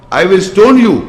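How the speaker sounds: loud, high retold threat; angry father. Father threatening the son who rejects his gods, loudly angry. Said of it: "when he gets so angry".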